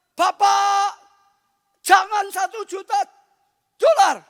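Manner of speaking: loud, high begging cry; hammed-up desperate cry to God; pleading shout. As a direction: shout the plea up to heaven.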